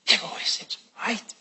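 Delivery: fast hushed whisper. Real, not acted. Preacher whispering to the congregation about brightness. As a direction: drop to a quick whisper.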